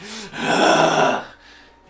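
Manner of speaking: long, loud breathy roar; battle roar. Acted. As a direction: let out a fighter's roar. Fighter roaring before brawl.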